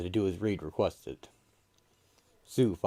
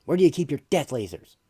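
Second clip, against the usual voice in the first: excited; 8 semitones higher, 8 dB louder, faster than usual. Acted. Johnny's excited demand.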